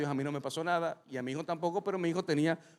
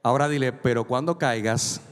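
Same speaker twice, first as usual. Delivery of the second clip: preaching at full force; 3 semitones lower, 7 dB louder than usual. Acted. Pastor has the congregation repeat to a neighbour, full preaching. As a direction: proclaim it so the back row hears.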